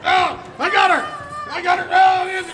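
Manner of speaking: high, panicked yelling; frantic shouting. Acted. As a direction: yell in a struggle to hold her. Robbers grabbing a fleeing hostage.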